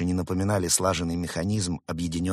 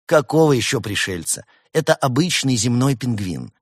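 loud, higher-pitched protest; indignant raised-voice correction; exasperated retort; 5 semitones higher, 7 dB louder than usual. A narrator's reading. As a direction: argue back hotly at full voice. Fox argues with the kidnapper.

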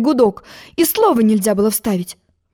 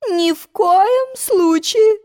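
exclaiming; 10 semitones higher, much slower than usual. A narrator's reading. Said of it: "воскликнула королева".

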